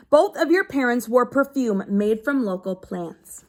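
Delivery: steady read-aloud to a class; reading to children. Acted. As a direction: read the page out to the kids. Teacher reads a book aloud.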